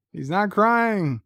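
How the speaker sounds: "He's not crying" drawn out slowly, pitched high; insistent. Retold or imitated. O'Brien insisting to Worf about the baby, high drawn-out insistence on "crying". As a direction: act out baffled insistence, stretching high on "crying".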